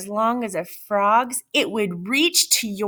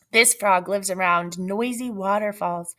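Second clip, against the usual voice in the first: usual speaking voice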